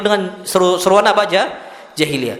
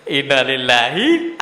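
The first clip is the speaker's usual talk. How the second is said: low, stretched words with a rising finish; retold phrase, eased out slowly and lifted at the end; slow drawn-out recital; 8 semitones lower, slower than usual. Saying the phrase over the lost goat, slow and drawn out.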